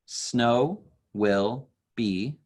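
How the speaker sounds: "snow will be" drawn out slowly, one beat per word; counting syllables. Shown as a demonstration. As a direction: count each syllable slowly on your finger. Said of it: "The second line".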